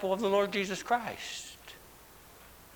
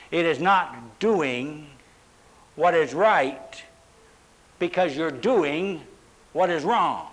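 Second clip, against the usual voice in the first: slow, measured, "doing" pressed; stress on doing; 3 semitones lower, slower than usual. Shown as a demonstration. Pastor explaining iniquity to his congregation, measured. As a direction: spell it out word by word. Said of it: "The emphasis is on doing".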